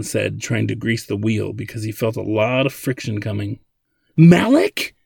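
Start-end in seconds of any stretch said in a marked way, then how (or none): 4.2-4.9 loud, high startled question; blurted. A narrator's reading. Zadeh reads the sender's name, blurting it out. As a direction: blurt it out in disbelief. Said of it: "Zadeh blurted as she downloaded".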